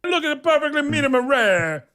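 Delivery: high, hammed-up show-off cook; chef character voice. Comedian mimics the TV cook showing his pork, character voice. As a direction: present the dish in a high put-on voice. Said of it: "he goes".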